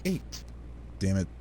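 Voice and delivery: ordinary delivery, nothing marked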